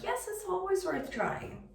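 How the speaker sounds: high, drawn-out pep talk to self; lightly acted, high-pitched reassurance; emphatic self-encouragement. Answers own doubt in self-talk.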